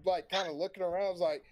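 ordinary delivery

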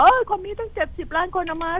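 high-pitched mock whining complaint; mock complaining. Host mimics people griping about vaccine doses, high mock whine. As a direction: squeak it like a griping citizen. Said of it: "เราอย่าไปโวยวายว่า".

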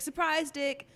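high-pitched mock "surprise!"; imitated gotcha announcement; mock surprise reveal. Mimics a man springing a surprise.

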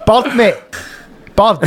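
"Bird" said the Glasgow way, close to "budd"; local slang answer. Retold or imitated. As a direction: answer "Bird" with a short, open local vowel. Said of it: "I, I was like".

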